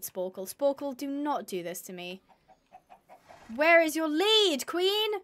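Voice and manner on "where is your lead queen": slow, raised-pitch demand, climbing on the last word; exasperated demand. Real, not acted. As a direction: draw it out louder, losing patience. Asks again, exasperated with animal.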